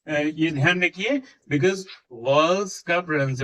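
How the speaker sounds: usual speaking voice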